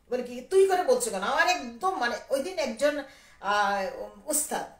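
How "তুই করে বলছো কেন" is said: loud, high indignant question; retold anger. Challenging the lyricist over informal address, retold anger. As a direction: demand an explanation, raised and louder. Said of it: "আমি একদিন heavy রাগ".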